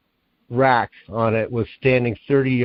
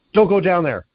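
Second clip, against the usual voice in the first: higher-pitched retold warning; mock yell with raised pitch; loud, mean order; 7 semitones higher, 3 dB louder than usual. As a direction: imitate a stern father calling out. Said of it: "really loud and mean voice".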